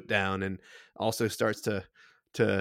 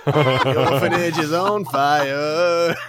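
loud, very slow comic lament; weird accent; 4 semitones higher, 8 dB louder, much slower than usual. Acted. Game master's stranger repeats the news to laughing players, loud weird wail. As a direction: bellow it drawn out in an odd accent. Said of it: "high-pitched, kind of weird accent".

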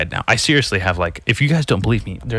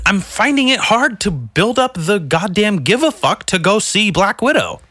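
higher, louder exasperated rant; 11 semitones higher, 4 dB louder than usual. Acted. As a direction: whine about the late movie.

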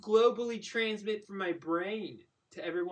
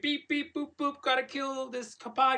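higher, beeping brainwashed voice; alien voice; 6 semitones higher than usual. Pretend. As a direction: beep like a hijacked robot mind. Brain hacked, Manchurian Candidate bit.

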